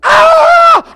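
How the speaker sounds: roaring threat, hugely loud and high; mock angry yell. Preacher acting out a parent losing control, raging shout. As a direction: bellow like a furious parent losing it. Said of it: "Then you go".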